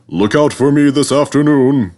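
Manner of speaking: boasting. A narrator's reading. Gordon boasting to little Edward, loud and proud. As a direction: puff yourself up and show off. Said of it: "said Gordon".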